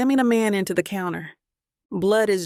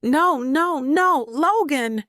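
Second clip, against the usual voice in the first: high, loud, slow desperate no's; panicked pleading; 7 semitones higher, 3 dB louder, slower than usual. A narrator's reading. She kneels by wounded Logan, begging in panic. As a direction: beg him not to die.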